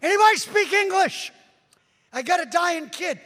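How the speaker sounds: loud, high desperate yell. Retold or imitated. Preacher yelling to the army across the border. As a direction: yell across the river to soldiers. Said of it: "I'm yelling".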